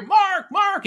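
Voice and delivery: high-pitched call across a busy room. Retold or imitated. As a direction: call his name to get his attention. Friends calling him across hall.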